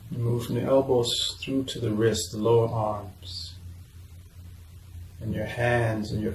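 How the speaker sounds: hushed, even relaxation-exercise delivery; guided meditation. Real, not acted. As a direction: lead them gently through relaxing the body.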